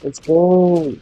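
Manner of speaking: hyped up. Real, not acted. Gamer kicking off the battle, excited and sing-song. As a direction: pump yourself up for the fight.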